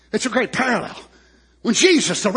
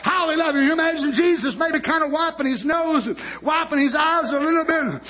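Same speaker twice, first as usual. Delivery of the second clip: high, driving sermon delivery; full-force preaching; 8 semitones higher than usual. Acted. Preacher retelling Lazarus' raising to the congregation, fervent preaching. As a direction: preach it at full pitch without letting up.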